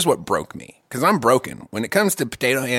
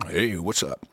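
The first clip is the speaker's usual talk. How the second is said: low, soft, laid-back hello; chill greeting; 9 semitones lower, 8 dB quieter than usual. Retold or imitated. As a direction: greet casually as if nothing is wrong. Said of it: "It's like fucking Lincoln, just".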